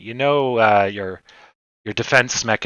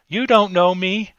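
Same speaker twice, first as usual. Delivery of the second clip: Karen voice; 9 semitones higher than usual. Retold or imitated. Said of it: "her signature attack".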